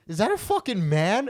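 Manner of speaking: high, loud shocked question. Retold or imitated. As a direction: yell it in mock disbelief. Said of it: "she was so shocked".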